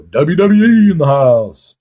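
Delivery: booming strip club PA intro; club announcer. Retold or imitated. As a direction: announce it over the loudspeaker. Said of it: "they would announce over the loudspeaker".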